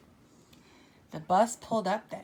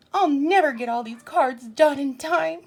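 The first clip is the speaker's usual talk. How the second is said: louder, higher wail of despair; exasperated cry; 6 semitones higher, 8 dB louder than usual. Acted. Pete despairs over cards.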